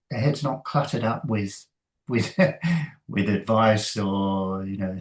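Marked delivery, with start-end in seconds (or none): 2.4-3.0 quick amused laugh; a brief laugh at himself; short laugh. Real, not acted. Laughs mid-sentence.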